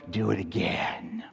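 mock whisper of Do it again; whispered demand. Crowd begging Jesus to multiply loaves again, mock whisper. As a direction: hiss the crowd's wish under the breath.

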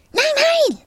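loud, high outraged refusal; hissed protest. A narrator's reading. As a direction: snap back at high pitch. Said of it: "fauchte Rüdiger".

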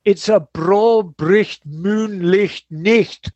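slow hammed-up Glaswegian; Scottish accent. Host hams up a Glasgow phrase from the listener's email. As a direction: drag out the broad Scots words. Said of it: "I'm a Scotsman from Glasgow".

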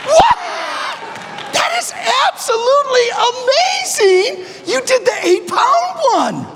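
real astonishment bursting out high; amazed shout. Real, not acted. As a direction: shout it like you can't believe it. Amazed at student's lift.

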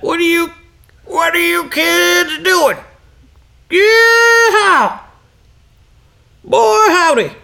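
put-on very high, drawn-out old prospector voice; old Texan man. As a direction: whoop it out like a Texan old-timer. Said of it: "he grew up in Texas".

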